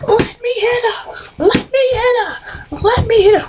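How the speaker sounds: very high, drawn-out shriek; hammed-up squealing cry; mock screaming. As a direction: imitate a wailing, thwarted fighter. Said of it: "Shelly's sitting there screaming".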